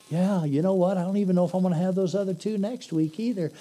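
high, fast laid-back buddy; stoner dude. Acted. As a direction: rattle on like a chilled-out buddy. First buddy replies.

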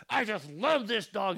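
loud, high mock-furious yell; put-on angry scolding, drawn out and forceful; anger voice. Shown as a demonstration. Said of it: "with your anger voice and go".

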